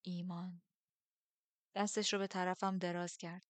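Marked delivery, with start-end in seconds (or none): 0.0-0.6 hushed, understated echo of the word; softly repeated. A narrator's reading. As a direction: repeat it quietly, with restraint. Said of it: "تکرار کرد".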